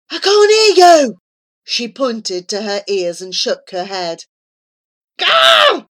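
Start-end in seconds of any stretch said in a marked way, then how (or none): 0.1-1.2 loud high hushed yell; whisper yelling. A narrator's reading. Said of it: "Flora whisper shouted back". 5.2-5.9 high loud frustrated yell; frustrated cry. A narrator's reading. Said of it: "cried Charlie, frustrated".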